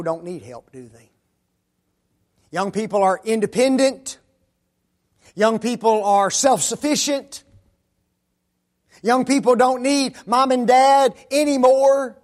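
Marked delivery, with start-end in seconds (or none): 2.5-12.2 loud, high ringing sermon lines; preaching at full force. Acted. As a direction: hammer each claim like a preacher.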